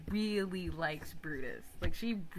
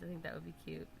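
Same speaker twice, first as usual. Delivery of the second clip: ordinary talk, nothing marked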